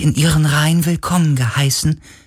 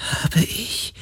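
embarrassed; 7 dB quieter than usual. Acted. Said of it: "Der Geist klang verlegen".

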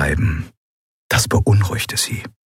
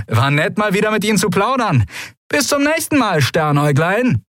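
high, quick, cocky call; pirate's taunting farewell; 13 semitones higher than usual. A narrator's reading. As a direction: call a cheeky goodbye while hauled off. Pirate's taunting farewell while dragged away.

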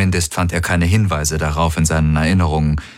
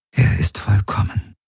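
whispering in tender awe; whispered; 3 semitones higher than usual. A narrator's reading. Ylana gazing at her new baby, whispering. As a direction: whisper it adoringly over a newborn. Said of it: "flüsterte Ylana".

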